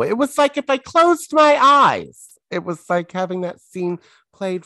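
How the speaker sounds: high and loud gushing; thrilled by the impression; excited. Real, not acted. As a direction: burst out in delight. Excited praise.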